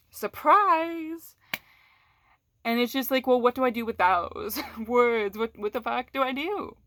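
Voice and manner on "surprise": sing-song mock cheer, much higher; sarcastic surprise. Real, not acted. Speaker mocks a partner who came across badly, with sarcastic glee. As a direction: throw it out like a sarcastic gotcha. Sarcastic reveal after newsflash.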